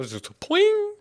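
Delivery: high pitched pling sound; springy pling. Shown as a demonstration. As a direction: pop it out like a spring. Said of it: "exactly that kind of sound effect".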